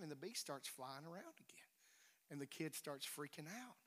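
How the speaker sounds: whispered suspense; hushed telling. Acted. Preacher telling the bee story, softly whispered. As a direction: draw them in with a whisper.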